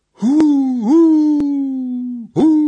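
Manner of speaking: long, loud, high hoot; eerie drawn-out conjuring hoot; witch's hooting howl. A narrator's reading. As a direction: howl piercingly on a held high note. Witch howls during her conjuring.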